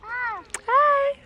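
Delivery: tiny squeaky hi to the kitty; high-pitched pet greeting; very high cooing hi. Real, not acted. Cooing at a cat.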